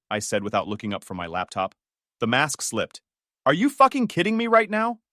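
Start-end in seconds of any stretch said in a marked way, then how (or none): 3.5-5.0 high, loud angry outburst; furious shout. Acted. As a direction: explode at him in disbelief. Mask slips, she loses it.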